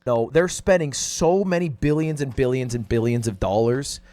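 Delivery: usual speaking voice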